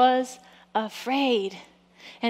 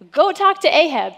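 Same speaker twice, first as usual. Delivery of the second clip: loud, high-pitched order; acted command; 4 semitones higher, 7 dB louder than usual. Preacher voicing God sending Elijah to Ahab, loud acted command. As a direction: fire off another mission aloud.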